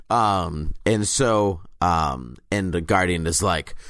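ordinary talk

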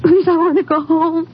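pleading. Acted. Grieving girl begs to go home.

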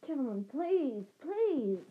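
rising high plea; crying out. Acted. Shop assistant crying out to brawling men, pitched high. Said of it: "cried the assistant".

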